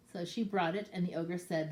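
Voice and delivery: ordinary delivery, nothing marked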